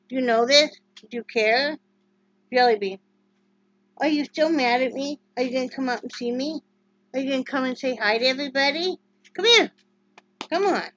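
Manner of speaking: high, loud sing-song calling to the dog; high coaxing dog talk. Real, not acted. Owner coaxing her sulking dog to come over, high sing-song. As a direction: coax the pup to come over, high and bright.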